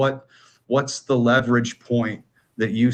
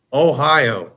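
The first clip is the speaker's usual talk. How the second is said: mock dramatic Ohio, louder; ironic zoomer; 3 semitones higher, 7 dB louder than usual. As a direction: say Ohio like a meme. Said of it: "I'm gonna talk like a Zoomer here".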